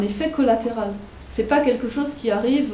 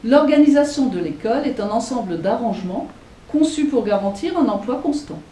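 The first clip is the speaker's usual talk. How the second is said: put-on louder, formal reading of an old text; reading a quote aloud; 3 semitones higher, 5 dB louder than usual. Teacher reading School Management aloud, louder formal reading. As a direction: declaim the rule as if from the book.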